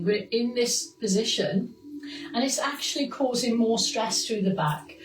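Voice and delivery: steady, clear fitness class cues; exercise instruction. Acted. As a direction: guide the class through each move. Leads posture exercises over music.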